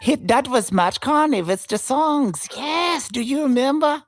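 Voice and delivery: high, excited mock German accent; imitating German tourist. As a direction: exclaim in a hammed-up German accent. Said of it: "I can just hear them now".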